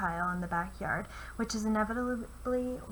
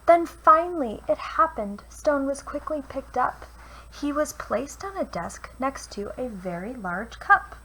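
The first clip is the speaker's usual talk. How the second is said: put-on lively read-aloud for children; storyteller voice for kids; picture book reading; 2 semitones higher, 6 dB louder than usual. A narrator's reading. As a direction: read it like bedtime rhymes. Reads a rhyming picture book.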